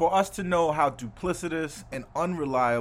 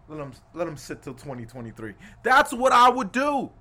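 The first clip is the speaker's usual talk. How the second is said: normal talking voice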